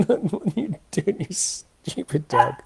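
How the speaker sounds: high, slow, silly telling-off; mock scolding the dog. Real, not acted. Owner teasing the puppy chewing his hoodie, high and slow. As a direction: tease the pup in a put-on voice.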